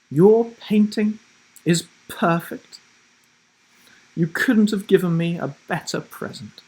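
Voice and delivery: a grandmother savouring the gift; delighted grandma. Acted. Grandma praising painting.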